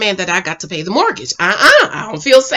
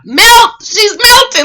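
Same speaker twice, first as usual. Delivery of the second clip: high, loud gushing outburst; excited swooning; 11 semitones higher, 7 dB louder, slower than usual. Real, not acted. Host gushing over a smitten woman, high excited outburst. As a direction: cry it out, carried away by her delight.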